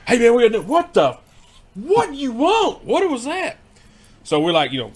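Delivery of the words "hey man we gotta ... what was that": much higher, louder, startled calling out; mock shout. Being called back in from smoking, higher and louder. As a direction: yell it like you're caught off guard.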